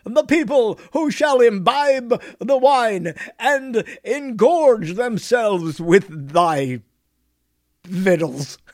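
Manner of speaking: slow, grand olde-worlde declaiming; slow theatrical mock Elizabethan speech; mock Shakespearean English. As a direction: ham it up like a Shakespeare player. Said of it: "speak in that language, that tone, that, uh, dialect".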